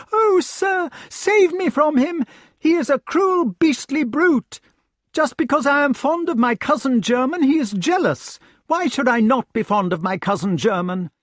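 very high, loud feminine plea; wailing aggrieved lady. A narrator's reading. Lady complains to Lancelot of her jealous husband, wailing. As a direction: protest in a high put-on lady's voice.

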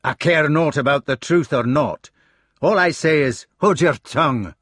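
loud, rising fury; angry rebuke. Acted. Gawain shutting his brother up, loud and angry. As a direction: slam the order home.

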